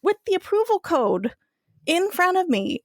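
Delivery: high, insistent protest. Retold or imitated. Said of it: "I'm like".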